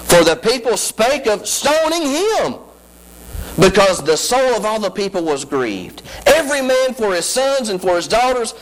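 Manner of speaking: high, rising preacher's whoop; preaching at full force. Acted. Preacher whooping to the congregation about her struggle. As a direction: lift it into a sung sermon cry.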